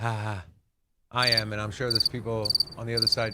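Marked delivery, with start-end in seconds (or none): 0.0-0.4 low, deliberate "ha ha"; forced fake laugh. Colleague defending his joke, forced deadpan laugh. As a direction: spell out a humourless laugh. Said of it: "Nobody's laughing".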